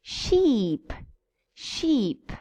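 Sheep with a long, drawn ee. Shown as a demonstration. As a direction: hold the ee in Sheep. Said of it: "Let's see the difference".

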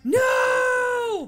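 long, high, loud wail of no; mock-horrified cry. Viewer protesting at the film's RC Cola drinker, horrified cry. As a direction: cry out in drawn-out mock horror.

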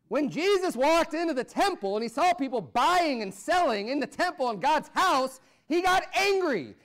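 high, building preacher cry on out; rising preaching. Acted. Pastor telling the temple story with full preaching force.

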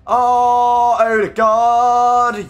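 loud, very slow groan of the name; drawn-out anguished curse. Real, not acted. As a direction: drag out the frustration. Player wastes chance.

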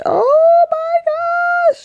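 very high, drawn-out squeal; squealing delight. Real, not acted. Vlogger gushing over her new bag, squealing. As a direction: squeal with joy.

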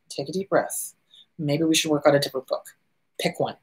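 hushed, low and gentle; calm reassurance. Acted. Therapist calming a client in tears over a book, soft reassurance. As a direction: talk them down softly and low.

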